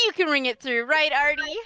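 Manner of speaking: chummy shopkeeper. Acted. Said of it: "I'm like".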